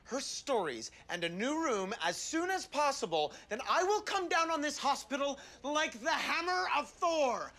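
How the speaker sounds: slow, booming grand threat; grandiose threat. Acted. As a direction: threaten like a thundering god.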